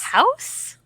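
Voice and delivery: surprised. Real, not acted. Both picked same answer.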